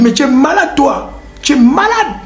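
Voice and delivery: high, indignant mock scolding down the phone; mock outrage. Boss scolding his friend on speakerphone, mock outrage. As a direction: play it as a show of being the boss.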